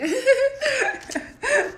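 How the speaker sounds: high, loud burst of laughter; shrieking laugh; high giggle. Real, not acted. Laughing at her mimicry.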